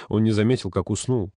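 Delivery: ordinary delivery, nothing marked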